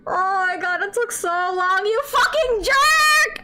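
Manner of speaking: high, loud, tearful shouting of joy; ecstatic scream. Real, not acted. Finally pulls wanted character.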